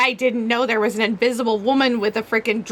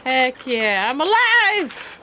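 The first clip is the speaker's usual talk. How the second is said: slow, much higher triumphant cry; excited cheer; 4 semitones higher, much slower than usual. Acted. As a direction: shout it out with joy at being revived. Character revived from death.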